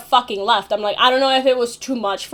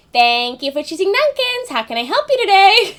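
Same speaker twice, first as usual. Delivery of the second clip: higher-pitched, singsong customer service greeting; 7 semitones higher than usual. Shown as a demonstration. Dunkin' worker greeting a drive-through customer, in high customer service voice. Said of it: "higher pitch 'cause I, like, that's my customer service voice".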